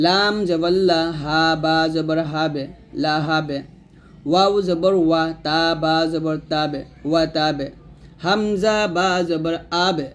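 spelling drill, হাবে, তাবে, আবে ending in bounced ব; qalqalah on ba. Shown as a demonstration. Teacher spelling Quranic words for learners, echoed ব in হাবে, তাবে, আবে. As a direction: let the ব in হাবে, তাবে, আবে rebound briefly. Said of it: "বা দিয়ে কলকলা".

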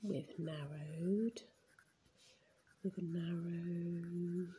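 very slow, stretched vowels; slow writing-along voice; drawn-out dictation. Real, not acted. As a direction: stretch the words out as you write them. Saying words while writing them.

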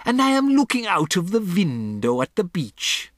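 put-on high, accented declamation; witch crying out. A narrator's reading. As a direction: proclaim it as a haughty witch. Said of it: "cried the Grand High Witch".

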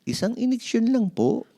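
put-on high young boy's voice, hopeful; piping little boy pleading for one shot; child voice. Mark tells his parent only one shot.

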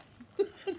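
quick high giggle; a bright burst of laughter; short high laugh. Real, not acted. Laughs at own boat idea.

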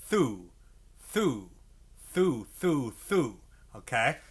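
soft repeated thoo, tongue tip on the reed; demonstrating tonguing syllables; tongued thoo. Shown as a demonstration. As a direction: say thoo thoo with a light tongue touch. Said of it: "I just kinda touch it with my tongue going".

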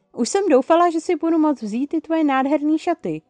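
high, loud lament; mock wailing. A narrator's reading. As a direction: wail it in comic despair. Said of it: "zanaříkala Chloe". (hlasité naříkání)

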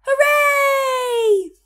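high, loud echoing cheer; echoed hooray. A narrator's reading. The echo cheering back at the friends, loud high shout. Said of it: "the echo shouted back".

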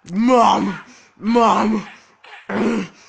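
loud calling shout; shouting for mom. Acted. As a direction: yell for mom across the house.

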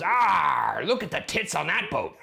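pirate voice. Pretend. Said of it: "when a pirate goes".

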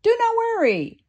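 cheerfully calm the scared customer; reassuring SpongeBob. Acted. SpongeBob calms the scared customer in a high, bright voice. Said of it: "SpongeBob replied".

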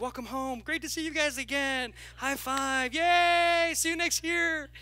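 high, bright hammed-up greeting with a laugh; mock cheerful welcome. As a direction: overdo the welcome to the Easter crowd. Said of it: "So it was like".

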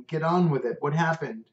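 exasperated, get on with it; grumbling. Acted. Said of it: "I grumbled".

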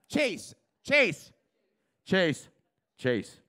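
comic calling the waiter's name across the club. Real, not acted.

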